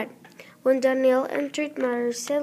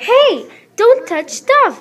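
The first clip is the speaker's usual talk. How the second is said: sharp warning; 9 semitones higher, 10 dB louder than usual. Acted. Teacher scolding student.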